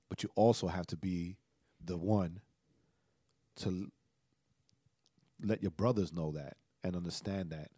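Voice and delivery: low and soft, in halting phrases; soft spoken. Retold or imitated. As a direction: gently hand down a hard truth, quietly. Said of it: "She, like, real soft spoken".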